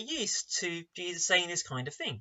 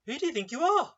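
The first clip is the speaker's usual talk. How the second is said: higher, rising challenge to the listener; raised rhetorical challenge; 7 semitones higher than usual. Shown as a demonstration. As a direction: throw the question back up. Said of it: "That is the rhetorical question".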